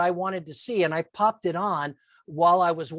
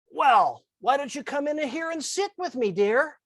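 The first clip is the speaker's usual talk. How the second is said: higher, sweetly calm invitation; 6 semitones higher than usual. Retold or imitated. Relaxed boss inviting the assistant to sit with her, sweet. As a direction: coax them back in kindly.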